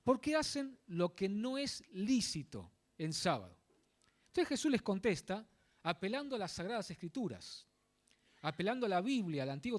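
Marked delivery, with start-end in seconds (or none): 0.0-3.6 slow, word by word, weight on "lícito"; stress on lícito. Real, not acted. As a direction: pause around "lícito" to make it stand out. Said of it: "recalco esta palabra". (recalcar "lícito", despacio y con pausas)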